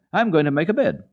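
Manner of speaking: high, loud happy call. A narrator's reading. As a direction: shout the plan down gladly. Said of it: "called out".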